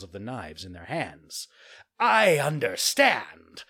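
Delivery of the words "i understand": loud, slow villain voice; dark realisation; one assassin to the other, loud and slow; sinister. Acted. Assassin speaks to partner.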